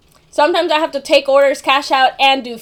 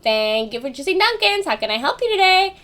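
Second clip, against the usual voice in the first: singsong greeting climbing higher towards the end; customer service voice; 6 semitones higher than usual. Shown as a demonstration. Dunkin' worker taking an order, in customer service voice. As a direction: lift into a high, polite service-counter pitch. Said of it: "that's my customer service voice".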